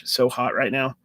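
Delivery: ordinary talk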